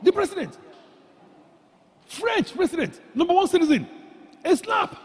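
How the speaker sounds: high, loud, incredulous outburst; mock shock. Preacher aghast that a president got slapped, incredulous. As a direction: exclaim it high in scandalized disbelief.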